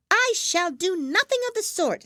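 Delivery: high, loud, huffy mouse voice; offended mouse. A narrator's reading. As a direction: refuse in a huff as the mouse.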